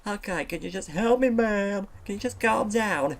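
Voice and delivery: high, loud exasperated snap; fed-up seal telling the otter to calm down. Acted. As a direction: lose patience with him. Seal losing patience.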